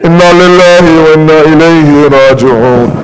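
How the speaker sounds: mournful Arabic recitation; solemn chanted prayer for the dead; closing recitation. Real, not acted. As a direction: intone the closing verse in grief.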